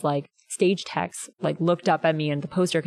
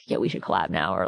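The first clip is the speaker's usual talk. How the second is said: much lower, casual dude voice; mock bro voice; 11 semitones lower than usual. Fan imagining pitching a collab to the singer, much lower mock bro. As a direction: drop low like a chill bro.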